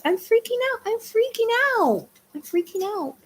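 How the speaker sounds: high mock panic that the dog reacts to; high playful squeal. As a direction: squeak it out teasingly.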